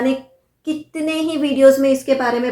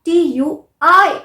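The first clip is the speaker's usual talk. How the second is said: टी यू आई slowly, आई punched louder; stressed spelling; 3 semitones higher, 5 dB louder, much slower than usual. Shown as a demonstration. Teacher corrects the spelling, slow with आई stressed. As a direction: spell slowly, hit the आई hard. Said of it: "सही spelling है".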